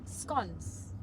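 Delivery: softly put-on British vowel; amateur British accent; British accent attempt. Shown as a demonstration. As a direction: have a go at sounding British. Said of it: "Can you do a British accent?".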